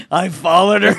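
words spilling through laughter; laughing. Real, not acted. Friend joking about stalking the teller, laughing. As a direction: laugh as you say it.

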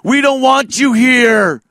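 loud, high chanted heckle; mock protester shouting. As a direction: shout them down like a megaphone protester. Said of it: "just loudly speaking in a megaphone over them".

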